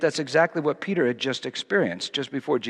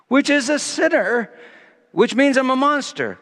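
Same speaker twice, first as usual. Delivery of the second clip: loud, high, full-force preaching; a preacher building to a climax; fervent preaching; 9 semitones higher, 7 dB louder than usual. Acted. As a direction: preach it out with rising fervour.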